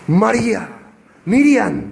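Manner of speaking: calling her name with wonder; exclaimed name. Shown as a demonstration. Jesus recognized, calls out her name with feeling. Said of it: "Con un tono más".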